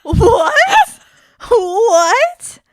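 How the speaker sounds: high, loud, laughing disbelief; shocked. Real, not acted. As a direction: yell it in shock. Shocked by the Jesus clue.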